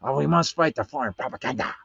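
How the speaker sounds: quick, lightly put-on Russian accent; mock Russian accent. Host redoing his old video line in a mock Russian accent. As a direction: play a cartoon Russian propagandist in a Russian accent. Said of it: "that Russian voice".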